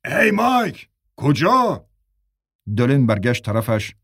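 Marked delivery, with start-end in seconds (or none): 0.1-1.9 high calling shout; high-pitched shout down the stairs. A narrator's reading. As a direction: call after Mike as he leaves. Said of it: "بیشاپ داد زد".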